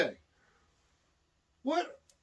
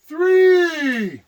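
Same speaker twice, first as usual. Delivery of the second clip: long, loud shouted count; drawn-out shout; 8 semitones higher, 7 dB louder than usual. Real, not acted. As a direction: belt it out to needle him. Chanting three again.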